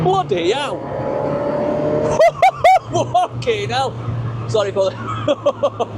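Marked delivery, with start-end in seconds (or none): 0.0-0.8 a higher, startled burst of surprise; astonished exclamation. Real, not acted. Fast car surprises driver. 0.8-2.9 high, giddy burst of laughter. Real, not acted. Laughs after exclaiming in car. 2.9-3.9 slow, higher, drawn-out disbelief; stunned exclamation. Real, not acted. Exclaims again at speed.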